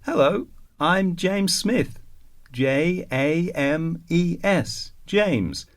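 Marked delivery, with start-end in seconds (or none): none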